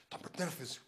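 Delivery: ordinary talk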